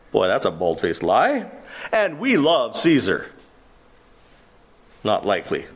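ordinary talk, nothing marked

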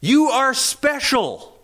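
high, slow, coaxing flattery; butter John up, leaning on "you"; flattering disciples. Acted. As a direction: disciples pleading with John about his standing. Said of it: "In other words".

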